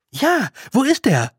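impatient and pressing, pitched well up; impatient demand. Acted. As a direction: demand to know where he is. Impatient demand for missing brother.

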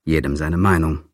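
plain reading voice

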